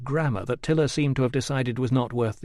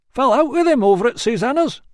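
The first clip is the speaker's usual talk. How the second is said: higher, eager gossip's patter; higher chatty barber voice; 12 semitones higher, 7 dB louder than usual. A narrator's reading. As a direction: prattle on cheerfully to the customer.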